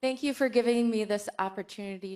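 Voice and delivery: loud, clearly projected thanks; speaking up. Real, not acted. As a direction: project it so the room hears. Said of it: "Speak up".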